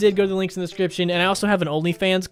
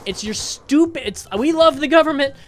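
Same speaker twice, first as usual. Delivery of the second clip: high, strained, worked-up outburst; exasperated rant; 9 semitones higher, 3 dB louder than usual. Retold or imitated. Man retells snapping at a useless government clerk, exasperated. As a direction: protest in a high-pitched, indignant voice.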